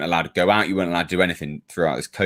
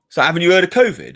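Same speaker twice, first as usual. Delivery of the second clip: higher and louder, a hint of the accusing neighbour; mock snide neighbour; 8 semitones higher, 5 dB louder than usual. Retelling the neighbour's jab across the road, mock snide and higher.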